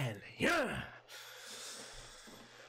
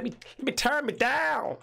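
high playful voice; 9 semitones higher, 6 dB louder than usual. Acted. Mock high voice adjusting mic.

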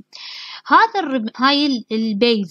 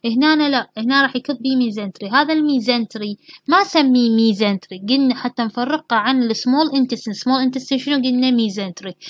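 ordinary talk

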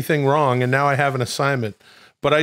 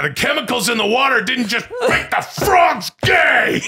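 raging higher, louder rant over the frogs; Alex Jones shout; 10 semitones higher, 4 dB louder than usual. Pretend. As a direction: scream it like a conspiracy ranter. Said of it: "I gotta do that every time I say".

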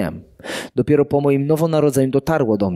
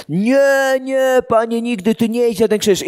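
higher, pleading protest; pleading Peter; 8 semitones higher than usual. Acted. Peter begging Jesus not to go to the cross, high pleading. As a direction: beg him not to go.

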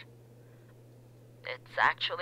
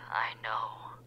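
whispering; 7 semitones lower, 5 dB quieter than usual. Acted. Caller whispers.

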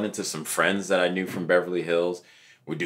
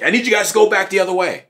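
higher, louder, fast command; riot cop order; 11 semitones higher, 6 dB louder, faster than usual. Retold or imitated. Riot-gear officer tells the crowd to turn back, brisk order. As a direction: clip through it like a cop in gear. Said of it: "He's like".

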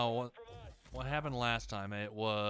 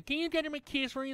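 high, put-on whiny voice mimicking her; mocking cousin. Mocking the cousin who dumps her kids, in a high whiny voice. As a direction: squeak it out like a pushy cousin.

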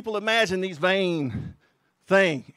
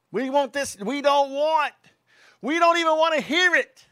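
higher, loud and indignant sermon voice; fiery preaching; 8 semitones higher, 5 dB louder than usual. Acted. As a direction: rail against them from the pulpit. Preacher mocks the world's cry.

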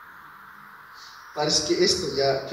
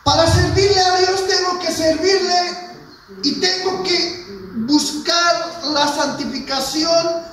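high, loud preaching, slowing into drawn-out words; full-force preaching; 13 semitones higher, 7 dB louder, slower than usual. Acted. Preacher urging the congregation to serve God, shouted preaching.

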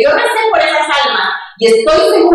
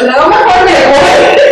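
laughing; 4 semitones higher, much slower than usual. Retold or imitated. Leaving awkwardly, laughing at herself.

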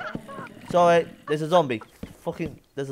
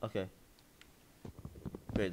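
ordinary talk, nothing marked

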